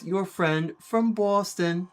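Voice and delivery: put-on slow Boston accent from a beer commercial; drawn-out Boston pitchman impression. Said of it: "watching the Boston Lager commercial".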